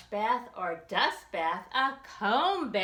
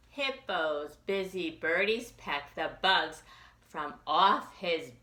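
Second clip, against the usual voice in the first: usual speaking voice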